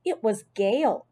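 plain reading voice

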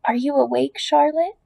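usual speaking voice

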